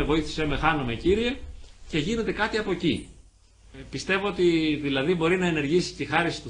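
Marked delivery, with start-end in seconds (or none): none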